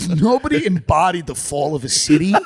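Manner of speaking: high, loud laughing praise. Real, not acted. Mocking the mayor while laughing. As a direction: laugh through the mock praise. Laughing mock tribute to Lightfoot.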